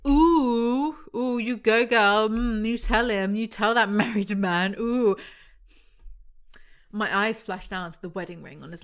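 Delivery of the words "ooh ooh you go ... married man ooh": put-on loud, teasing "you go girl" hype; hammed-up, scandalised egging-on; mock cheering on. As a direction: whoop her on sarcastically. Mock cheering on the book's heroine.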